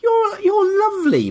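mock-gushing praise; gushing compliment. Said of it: "I always just want to stop her and say".

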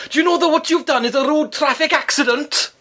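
high indignant mock voice of an older man; pompous neighbour. Neighbour lectures her at the door, high and pompous. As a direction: puff up like an officious ex-copper.

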